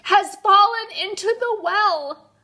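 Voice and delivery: high, loud, broken bursts between gasps; gasping. A narrator's reading. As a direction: fight for breath after every few words. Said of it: "he gasped".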